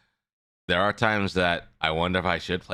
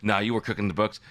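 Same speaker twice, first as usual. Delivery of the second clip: normal talking voice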